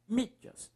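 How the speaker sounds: "mídias" with a held MÍ; stressed syllable. Shown as a demonstration. Teacher confirming the strongest syllable, stress on MÍ in "mídias". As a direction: lean on the first syllable of "mídias". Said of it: "Mi é a sílaba mais forte".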